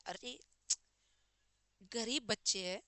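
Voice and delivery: ordinary delivery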